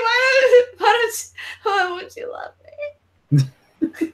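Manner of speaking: high crying plea, slow at the end; ugly cry. Retold or imitated. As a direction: plead it through sobs. Fan wailing at idol.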